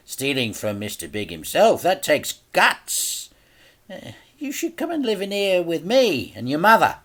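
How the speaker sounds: put-on a smug boss tempting the boy; Mister Big, gloating. A narrator's reading.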